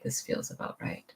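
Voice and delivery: soft unvoiced whisper. Shown as a demonstration. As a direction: breathe it out as a whisper.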